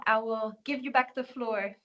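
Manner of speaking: ordinary delivery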